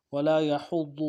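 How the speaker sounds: normal talking voice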